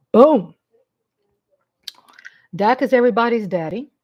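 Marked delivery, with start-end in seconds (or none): none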